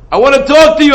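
loud plea. Acted. God pleading, voiced by preacher.

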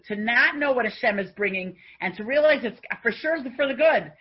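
rising fervent sermon; fervent preaching. Acted. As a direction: preach it to the congregation with fire. Preacher exhorts the listeners.

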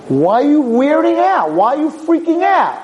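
very high, drawn-out questioning; hammed-up high-pitched disbelief; incredulous mock challenge. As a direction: squeal it like an exasperated spouse. Retelling wife's challenge to him.

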